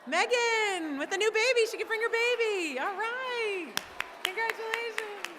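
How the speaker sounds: high, bright congratulating; excited cheer. Real, not acted. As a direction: gush over the winner. Cheering the winner.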